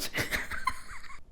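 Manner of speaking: real laugh, high and airy; high burst of laughter. Real, not acted. Narrator cracking up at the scene, high laugh.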